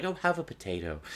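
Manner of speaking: soft, friendly mock offer; pleasantly and quietly, no edge. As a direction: imitate a genial host offering food. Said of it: "it was very pleasant".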